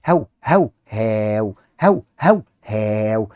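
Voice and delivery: put-on playful woofing for kids; dog bark. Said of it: "كلب بيقول هاو".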